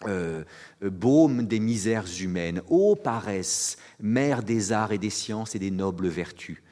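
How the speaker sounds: slow, loud, solemn declamation; declaimed quote. Retold or imitated. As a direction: proclaim it like an ode. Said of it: "Et il écrit".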